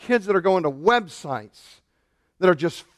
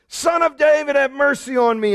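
high, pleading call; crying out; 7 semitones higher than usual. Retold or imitated. Preacher voicing the beggar crying out, high and pleading. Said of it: "he kept crying out all the more".

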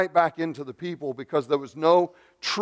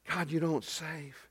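much softer, spent lament; hushed anguish; 11 dB quieter than usual. Acted. Prophet sensing no answer from God, quietly anguished. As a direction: let the cry drop to a quiet, despairing close. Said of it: "it's an anguished cry".

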